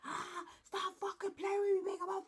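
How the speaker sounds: hammed-up furious boyfriend screaming down the line; yelling on the phone. Imitating her boyfriend yelling at her on the phone, a loud high mock yell. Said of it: "He's yelling like".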